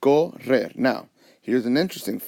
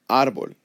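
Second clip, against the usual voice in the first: "árbol" with the voice up on the A; stress on first syllable; 3 semitones higher, 4 dB louder than usual. Shown as a demonstration. Teacher showing where the accent goes, stress on the A of "árbol". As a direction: hit the first syllable of "árbol", let the second drop. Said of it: "my voice goes up on the A".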